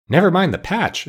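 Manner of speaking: a mild happy exclaim; happy exclamation. A narrator's reading. Tin Woodman thanking the wizard, mildly happy exclaim. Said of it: "exclaimed the happy Woodman".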